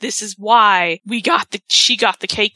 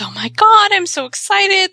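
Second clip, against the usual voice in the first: high, louder delighted gushing; excited; 9 semitones higher, 4 dB louder than usual. Retold or imitated. Wife seeing her four-tier cake arrive, mock excited gushing. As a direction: imitate a thrilled wife. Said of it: "But Maurice is excited".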